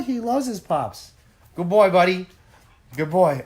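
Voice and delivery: high coo settling back down. Real, not acted. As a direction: let the doggy voice fade out. Baby talk fading to normal.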